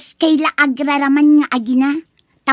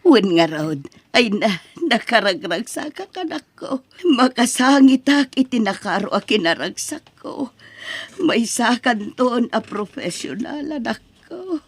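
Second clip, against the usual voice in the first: tearful joy; 3 semitones lower, 3 dB quieter than usual. Acted. Mother moved by son's graduation.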